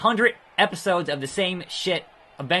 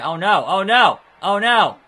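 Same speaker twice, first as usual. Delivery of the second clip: loud, higher alarmed outburst; alarmed cry; 5 semitones higher, 9 dB louder than usual. Real, not acted. Player reacts to a turn in the match, alarmed. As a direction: yelp it out in dismay.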